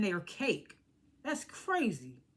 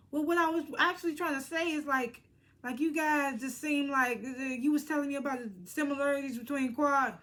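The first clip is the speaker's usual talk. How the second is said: higher, put-on sweet explaining voice; sugarcoated; 5 semitones higher than usual. Heavenly explaining herself to Sweet Tea, mock sugarcoating. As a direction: sweeten it up, imitating a careful backpedal. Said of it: "put some sweet, sweetener on it".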